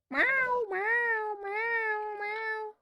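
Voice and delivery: high-pitched drawn-out meows; cat meows. Pretend. Streamer playfully filling a pause, high mock cat meows. Imitates a cat between remarks.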